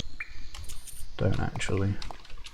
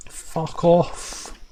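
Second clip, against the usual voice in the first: frustrated outburst; 7 semitones higher than usual. Real, not acted. Outburst at the game.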